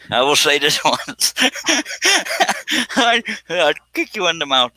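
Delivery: threat collapsing into high laughter; cracking up. Real, not acted. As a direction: try to threaten, fail with helpless laughing. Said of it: "I'd turn purple".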